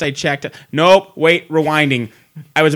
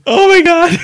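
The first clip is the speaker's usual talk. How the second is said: loud, very high-pitched exclamation; shocked outburst; 8 dB louder than usual. Real, not acted. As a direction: cry out in disbelief at your friend. Shocked friend has not seen film.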